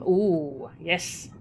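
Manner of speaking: ordinary delivery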